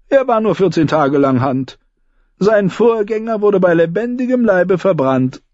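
dry ironic storyteller; a sardonic dwarf lord; Tyrion voice. Acted. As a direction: recount grim history with wry calm. Tyrion recounts the Hands.

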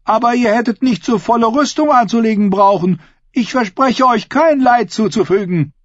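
high, projected mock reassurance; ringing, high-pitched public speech; bold woman addressing assembly. A narrator's reading. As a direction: tease your uncle before a crowd.

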